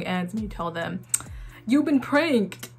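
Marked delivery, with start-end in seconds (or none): 1.7-2.7 higher, drawn-out announcement; playful prank reveal. Pretend. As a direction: draw out the reveal slowly and high.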